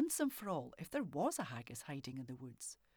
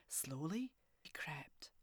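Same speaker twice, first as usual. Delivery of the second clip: usual speaking voice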